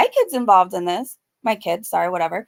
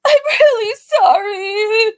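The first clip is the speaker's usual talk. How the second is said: hammed-up sobbing apology, much higher, slow; mock crying; 10 semitones higher, 4 dB louder, slower than usual. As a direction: wail it like fake tears. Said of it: "She cries".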